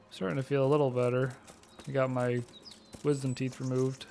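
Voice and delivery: talking carefully round fresh stitches; stitches in mouth. Real, not acted. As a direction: sore mouth after surgery. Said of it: "stitches in my mouth".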